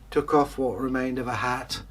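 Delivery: usual speaking voice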